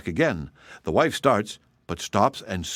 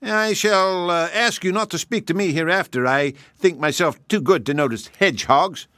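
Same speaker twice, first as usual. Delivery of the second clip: higher, sniffy hare voice; haughty hare; 8 semitones higher, 3 dB louder than usual. Acted. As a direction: look down on him as the proud hare. Hare snubs hedgehog.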